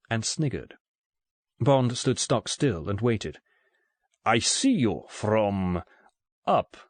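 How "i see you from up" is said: put-on slow, halting explanation in a thick Russian accent; good-humoured Russian accent. A narrator's reading. Russian gunman explains to captive Bond, good-humoured thick Russian accent. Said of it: "in a tone of good-humoured explanation".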